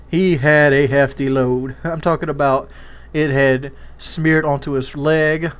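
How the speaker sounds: usual speaking voice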